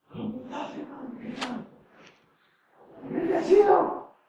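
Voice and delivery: whispered, rasped low greeting; deep rasp. Retold or imitated. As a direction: croak it with a wrecked voice. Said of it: "Mi voz está más, más bajo todavía".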